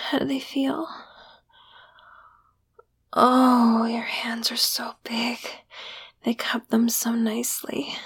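hushed, breathy whisper, barely voiced. To her partner in bed, a breathy whisper. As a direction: whisper it close into his ear. Intimate whispered lover roleplay.

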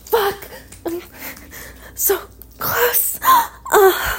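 rising, loud breathless cries; breathless moaning. Real, not acted. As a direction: build to high breathless cries. Climax building.

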